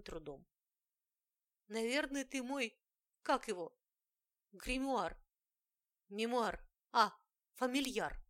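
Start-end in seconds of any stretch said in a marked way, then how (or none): 1.7-8.2 excited. A narrator's reading. Heroine guesses the word excitedly.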